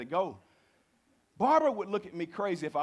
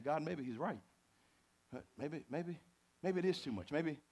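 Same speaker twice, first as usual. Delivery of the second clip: soft, much quieter doubting prayer; quiet retold prayer; 7 dB quieter than usual. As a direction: murmur it to God on your knees. Praying on knees, doubting.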